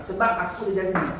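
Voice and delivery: ordinary talk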